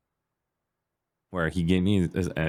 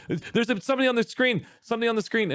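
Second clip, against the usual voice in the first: very high, frantic calls; panicked kid. Retold or imitated. Kid yells for his brother in panic. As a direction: yell to your brother in a panic. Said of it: "I'm screaming at my brother".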